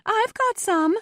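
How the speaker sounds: very high, loud volunteer from a crowd; woman offering. A narrator's reading. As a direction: pipe up eagerly over the crowd. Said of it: "a woman offered".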